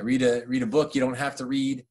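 ordinary talk

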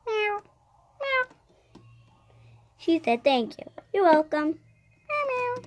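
high squeaky cat meows; kitten meows. Acted. Child pretending to be the cat, meowing high. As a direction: meow like a little kitten.

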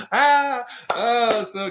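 high, loud, gasping laughter; helpless laughter. Real, not acted. Cracking up after a fan's joke, laughing hard. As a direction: laugh till it hurts.

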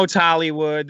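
ordinary talk

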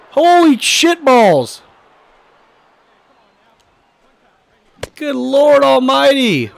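high, loud startled whoas and curses; shocked yelling. Real, not acted. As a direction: yell in disbelief at the screen. Big hit against his pitcher.

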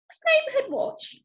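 put-on very high, puzzled child's question; curious boy. A narrator's reading. Boy asks his granny what it is, curious.

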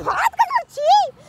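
high pitched disgusted whine; disgusted squeal. Real, not acted. Disgust at being touched. (घिन से ऊँची आवाज़)

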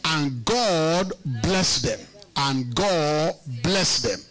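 "God" and "bless" each stretched long; slow down, leaning on "God" and "bless"; emphatic scripture repetition. Real, not acted. Preacher quoting the verse to the congregation, "God" and "bless" drawn out.

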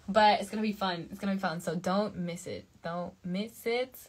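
ordinary delivery

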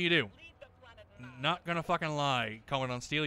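ordinary delivery

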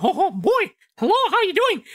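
very high falsetto cartoon mouse; Mickey Mouse. Voice actor compares characters, high mouse impression. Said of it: "Mickey Mouse, like".

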